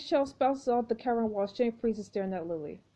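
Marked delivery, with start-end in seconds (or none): none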